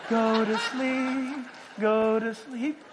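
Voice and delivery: slow, lulling mock devil voice, a lighter laugh in it; Satan lulling to sleep. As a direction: croon it like a tempter soothing you. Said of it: "Satan shows up with a violin".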